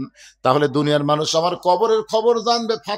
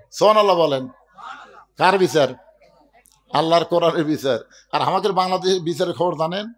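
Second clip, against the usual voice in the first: usual speaking voice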